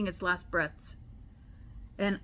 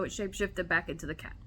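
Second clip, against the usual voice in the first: ordinary delivery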